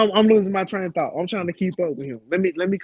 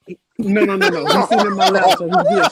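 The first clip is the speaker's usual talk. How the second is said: louder, laughing it off; laughing denial; 2 semitones higher, 6 dB louder than usual. Real, not acted.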